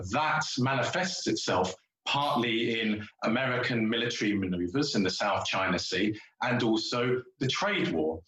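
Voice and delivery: ordinary talk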